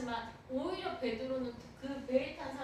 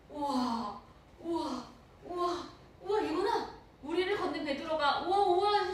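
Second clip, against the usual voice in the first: awed wows; 4 semitones higher, 6 dB louder, slower than usual. Acted. Awe at Peter walking on water.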